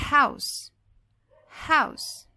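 "House" with a clearly breathed H at the start; audible H sound. Shown as a demonstration. Teacher lets students hear the H, audible H in "House". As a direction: say "House" loud and high, sounding the H. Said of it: "o som do H".

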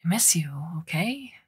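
barely voiced, breathy whisper; hushed, almost soundless admission; near-inaudible creak. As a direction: confess it under your breath. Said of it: "voice lowered to a nearly inaudible creak".

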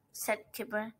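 usual speaking voice

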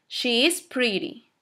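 slow, spaced SHE IS PRETTY, falling; model sentence for repeating. Shown as a demonstration. Teacher has students repeat after her, slow model sentence. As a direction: draw out SHE IS PRETTY for learners. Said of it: "repitan después de mí".

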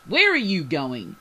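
loud, slow, exasperated demand; exasperated snap. A narrator's reading. Bryce catches Bailey heading back aboard, snapping in exasperation. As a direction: snap the question loudly. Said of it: "the exasperated Bryce snapped at her".

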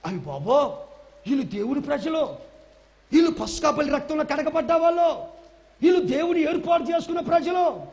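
preacher voicing the Red Sea in awe of God's people; Red Sea talking. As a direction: build from startled to declaring. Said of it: "ఎర్ర సముద్రం ఏమనుకుందో తెలుసా".

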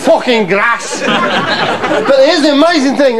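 can't stop laughing while saying it. Real, not acted. Laughing at the swear.

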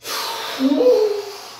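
high shaky sigh. Real, not acted. Shivering in ice bath.

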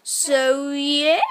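normal talking voice

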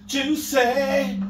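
JUICY drawn out, lifted high; stretched, high-pitched foodie relish; relishing the name. Real, not acted. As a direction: savour the word, slow and sung-out. Savouring restaurant name with relish.

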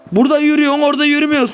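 high, loud indignant scolding; exasperated complaint. Real, not acted. Gamer scolding the game controls, exasperated high complaint. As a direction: scold the character for not walking.

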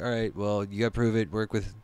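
a lightly voiced wary boss talking down to a recruit; skeptical agent voice. Agents reply to the outsider.

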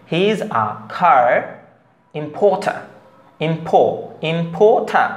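slow "car importer" as khaa im-pho-tha; aspirated k, p, t. Shown as a demonstration. Teacher modelling the rule, slow "importer" with aspirated p and t. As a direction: puff the consonants of "car importer", no r at the end of importer. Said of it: "টটা ঠয়ের মতন উচ্চারণ করতে হবে".

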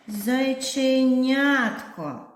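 зайченятко stretched slowly, syllable by syllable; drawn-out long word. Shown as a demonstration. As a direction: spell out зайченятко in slow separate beats. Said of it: "графічно зобразимо короткі та довгі слова".

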